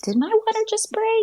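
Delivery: very high, squeaky retold question; puzzled disbelief. Woman on her way to yoga wondering, puzzled and surprised. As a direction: question it way up in pitch, unsure.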